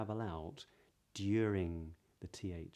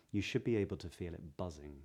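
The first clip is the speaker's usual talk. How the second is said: ordinary talk, nothing marked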